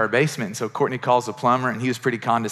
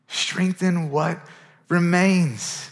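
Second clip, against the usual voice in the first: drawn-out, emphatic reading; slow emphatic; 4 semitones higher, much slower than usual. Acted. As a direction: lean on each word slowly. Preacher stressing key verse.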